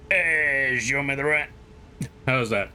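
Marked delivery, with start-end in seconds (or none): 0.1-1.5 strained New York smoker; Italian American New Yorker who sounds constipated; New York accent. Acted. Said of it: "How do you do a New York accent?".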